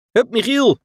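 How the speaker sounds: a high, loud, cheerful call across the roof. A narrator's reading. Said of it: "riep hij". (hoog en luid roepen)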